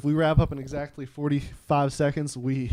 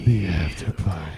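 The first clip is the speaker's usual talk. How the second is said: sultry voice; 10 semitones lower than usual. Pretend. Echoing the sultry welcome.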